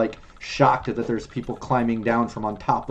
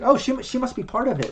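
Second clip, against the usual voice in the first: higher, lightly voiced passer-by; bystander; 10 semitones higher than usual. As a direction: reason it out like a convinced onlooker. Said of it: "people kinda looking like".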